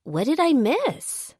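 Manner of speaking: high, slow, eager question; a bright high excited voice; eager girl. A narrator's reading. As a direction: perk up wanting the gossip.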